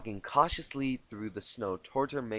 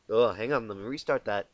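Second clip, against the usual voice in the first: plain reading voice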